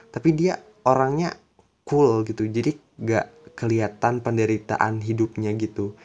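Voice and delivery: normal talking voice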